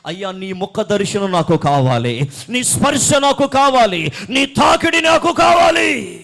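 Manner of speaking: very loud plea, rising in pitch each time; impassioned prayer cry. Acted. Preacher voices a believer begging for God's face, building to a shout. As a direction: escalate to a high, stretched shout.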